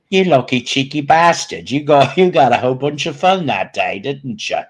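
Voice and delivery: loud, high-pitched mock English accent; badly faked English cheeky-chap voice; shitty English accent. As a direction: exuberant put-on English accent. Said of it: "There's my shitty English accent".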